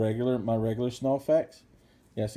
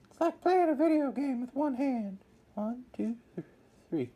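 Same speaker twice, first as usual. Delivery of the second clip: squeaky voice; 13 semitones higher than usual. Real, not acted. Squeaky aside while painting.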